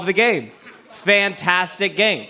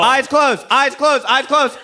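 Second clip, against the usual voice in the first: calling out; 6 semitones higher than usual. Real, not acted. Instructing the audience.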